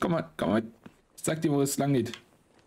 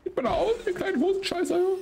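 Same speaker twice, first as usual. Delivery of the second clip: mock shooing voice. Shoos crowd of NPCs away.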